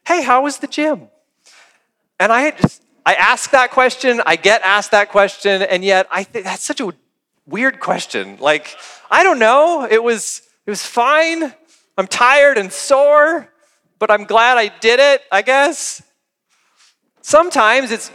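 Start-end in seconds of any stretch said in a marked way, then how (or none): 0.1-1.1 pitched-up breezy greeting; acted casual question. Spouse greeting partner home from the gym, pitched-up casual. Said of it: "the question is". 9.1-16.0 high-pitched, noncommittal shrug of a reply; acted uncertainty. Spouse answering about the gym, high and unsure.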